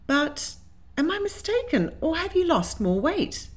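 high, hesitant concern; worried. A narrator's reading. Lady asks her suitor about lost weight, worried and high. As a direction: pause after the first word, fret upwards. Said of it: "she asked with a worried frown".

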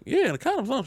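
mock high-pitched answer; high voiced reply. Retold bedroom dialogue, voiced.

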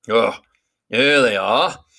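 a hint of a booming giant; Beorn the big man. A narrator's reading. Beorn speaking to his horses, hearty.

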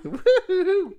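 playful imitation in piercing head voice. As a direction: squeal out a pop star's "woo-hoo".

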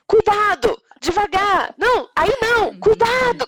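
anxious mom yelling careful at the playground; calling out warnings. Retold or imitated. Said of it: "a coisa que eu mais falo é".

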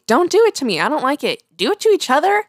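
ordinary talk

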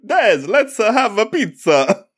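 very high, hammed-up Italian English; squeaky-high caricature of an Italian accent; mock Italian accent. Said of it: "exactly how Des and I speak English".

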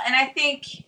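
ordinary delivery

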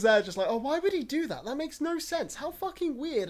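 high, mocking voice of posh onlookers; high mocking impression of posh people; mock posh outrage. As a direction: sneer it like shocked toffs.

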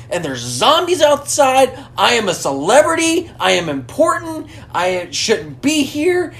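high-pitched retold meltdown; lightly hammed-up panicked protest; mock freak-out. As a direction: act out a self-important celebrity losing it. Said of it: "he's losing his mind".

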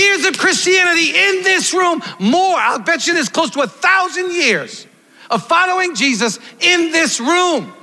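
fiery preaching. Real, not acted. Preacher challenging long-time believers to go tell others, fiery preaching. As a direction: preach it hard, punching each challenge.

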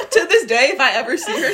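ordinary talk, nothing marked

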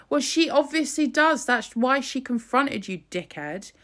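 loud, high, furious retort; angry outburst. Real, not acted. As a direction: snap at him in open outrage.

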